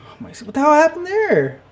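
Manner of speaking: loud, high-pitched disbelief; incredulous outburst. Real, not acted. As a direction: shoot up high in exasperated shock. Outraged at the game.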